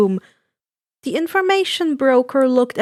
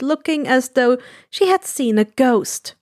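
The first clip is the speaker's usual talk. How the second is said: plain reading voice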